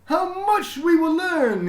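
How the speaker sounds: high, loud and slow, booming with pride; a beaming principal proclaiming to everyone; proud headmaster announcing. A narrator's reading. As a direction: announce it grandly to the whole school. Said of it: "he said".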